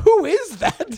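high, loud mock disbelief; incredulous cry, falling at the end; astonished question. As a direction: imitate a baffled onlooker butting in. Acts out Megan's astonished question.

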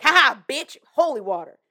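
loud mocking cackle of a demon; gloating demon. Demon taunting the coughing girl, loud jeer.

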